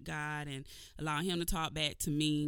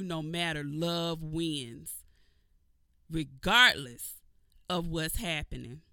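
normal talking voice

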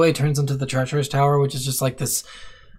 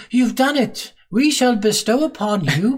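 higher, slow, ceremonial proclamation; grand solemn sages; 8 semitones higher, slower than usual. Retold or imitated. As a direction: bestow the gift like pompous ancient sages. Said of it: "and they're like".

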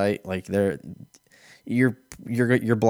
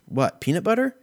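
much higher, disbelieving question; incredulous clerk; 12 semitones higher, 3 dB louder than usual. Retold or imitated. Comedian imitating clerk amazed at his question, high. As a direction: act baffled he'd even ask. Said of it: "And she goes".

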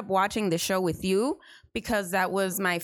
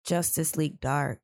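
deep sexy voice; 8 semitones lower than usual. Shown as a demonstration. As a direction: drop the voice low and sultry. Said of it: "You gotta make it sexier".

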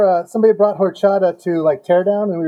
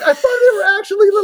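imitated bewildered excuse; 12 semitones higher than usual. Buddy's retold excuse.

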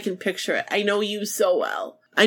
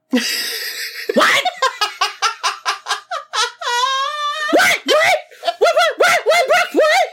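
cat-like shriek; slower than usual. Retold or imitated. Imitating Nikki hearing who is here, cat-like shrieking. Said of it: "you would sound like a cat".

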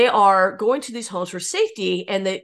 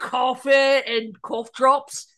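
slow, high, playful tipsy drawl; hammed-up drunk voice; mock drunk; 6 semitones higher, 5 dB louder, slower than usual. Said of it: "I'm drunk on coffee".

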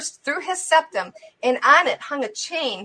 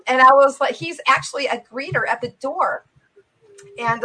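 ordinary talk

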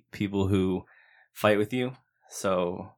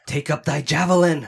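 shouting; 8 semitones higher, 6 dB louder than usual. Pretend. Mock screaming Bible verse.

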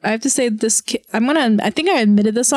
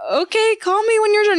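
higher and quick, laughing through it; laughing mock reply; 9 semitones higher, faster than usual. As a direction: toss it off fast while cracking up.

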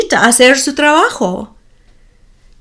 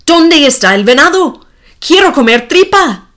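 fast, louder angry question; angry demand; 5 semitones higher, 4 dB louder, faster than usual. A narrator's reading. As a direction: demand it like a spoiled, furious boy. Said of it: "está enojado y pregunta".

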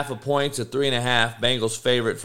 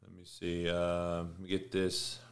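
low, much softer mutter under his breath; muttering to self; 7 semitones lower, 14 dB quieter than usual. Real, not acted. Host searching notes off-mic, quiet mutter. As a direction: drop out of the mic, talking to yourself. Muttering to himself mid-show.

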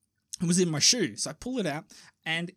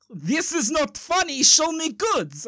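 put-on slow, high, emphatic Russian accent; hammed-up impatient Russian criminal, raised pitch; 8 semitones higher, 5 dB louder, slower than usual. Russian criminal losing patience with the newcomer, irate Russian accent. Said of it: "the Russian guy goes".

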